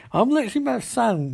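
high-pitched mock insistence; exaspéré. Exasperated villain retold in acted dialogue.

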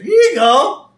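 high, loud imitated handover; helpful wife. Wife handing over food from the cooler, helpful. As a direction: sing out the offer up high.

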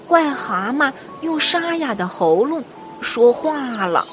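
ordinary delivery

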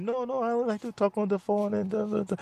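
high, soft put-on old man voice; mock a soft, high-voiced refusal; imitated soft-spoken man. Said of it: "he was speaking so low and so soft like this".

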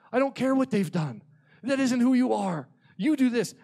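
loud, high impassioned cry to God; full-force desperate intercession, line by line. Retold or imitated. Youth pastor begging God for revival, loud crying out. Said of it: "I start crying out".

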